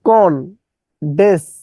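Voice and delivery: "কনডেনসেশন" in four isolated syllables; distorted spelling pronunciation. Shown as a demonstration. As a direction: chop "কনডেনসেশন" apart, syllable by syllable. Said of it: "বিকৃত উচ্চারণ করবেন". ("কনডেনসেশন" ভেঙে ভেঙে বিকৃত উচ্চারণ)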